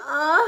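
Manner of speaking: short moan rising in pitch; faked moan. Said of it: "you will be faking it".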